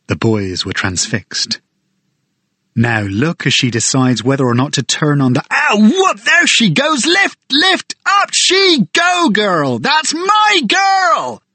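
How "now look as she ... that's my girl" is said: much higher, building to a shout of pride; excited bird guide. Acted. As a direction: cheer the eagle up into the sky like a proud handler. Guide cheering the eagle aloft.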